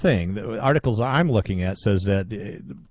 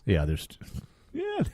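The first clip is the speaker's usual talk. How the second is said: usual speaking voice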